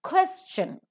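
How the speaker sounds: Question, high first syllable, light ending; stress on first syllable. Shown as a demonstration. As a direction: Question with QUES pitched high. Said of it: "the first syllable is accented".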